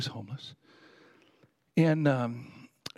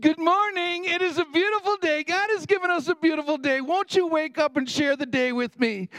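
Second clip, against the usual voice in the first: high, ringing morning greeting; clear sing-song; 12 semitones higher, 4 dB louder than usual. Retold or imitated. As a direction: call out in a singsong to wake someone. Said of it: "sing-songy voice".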